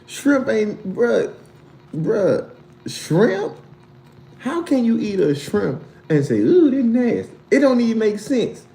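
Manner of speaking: high, heated outraged ranting; playful outrage over shrimp; outraged disbelief. Real, not acted. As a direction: scold the viewer in high disbelief.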